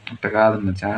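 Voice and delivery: hushed voiceless correction; whisper. Real, not acted. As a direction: breathe it, no voice, off-camera. Uncle corrects kid mid-take.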